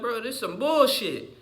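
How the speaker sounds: high exasperated complaint; annoyed. Retold or imitated. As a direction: drag it out, fed up. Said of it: "I'm like".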